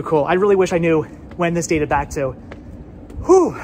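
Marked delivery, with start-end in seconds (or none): 3.3-3.6 loud puff after climbing stairs; winded exhale. Real, not acted. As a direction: blow out hard from the climb. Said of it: "So I'm quite winded".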